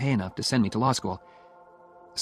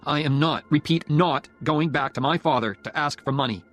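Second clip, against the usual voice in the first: raised, emphatic, indignant delivery; angry refusal; 5 semitones higher, 4 dB louder than usual. A narrator's reading. Student refusing to ask his father for money, angry. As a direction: refuse angrily, driving each point home. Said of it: "I said angrily".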